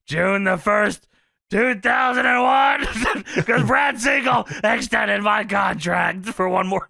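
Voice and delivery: loud, high, slow mock yell; Funk defiant. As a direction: drag out the date defiantly. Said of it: "Funk says".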